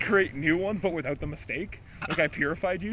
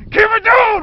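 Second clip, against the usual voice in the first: high, loud mock yell; shout; 14 dB louder, slower than usual. Mom in the story yelling at the screaming kid in a loud mock shout. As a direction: yell it from across the house.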